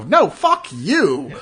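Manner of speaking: loud, drawn-out acted refusal; mock outraged refusal. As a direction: act out indignation, stretching the words. Acts out anybody's angry refusal.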